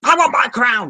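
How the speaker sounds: high, loud, petulant demand; mock royal tantrum, pitched high; Viserys. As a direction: cry out like a demanding would-be king. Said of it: "Going a bit Viserys".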